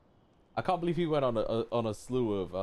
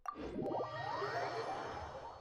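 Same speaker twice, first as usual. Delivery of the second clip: long breathy sigh; 9 dB quieter than usual. Real, not acted. Long sigh during quest.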